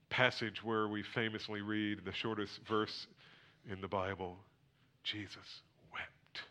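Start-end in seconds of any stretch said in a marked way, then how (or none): 5.0-6.4 slow hushed whisper; hushed reverent. Acted. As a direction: breathe the verse slowly. Reverent hush over verse.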